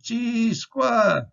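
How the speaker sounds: chi squa up high, playful; cheeky stall. Pretend. Student stalling the teacher, playfully high. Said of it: "You say".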